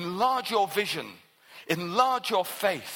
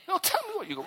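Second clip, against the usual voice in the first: much higher pressing demand; Elijah insisting; 12 semitones higher, much faster than usual. Retold or imitated. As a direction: push her to name what she has. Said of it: "she says".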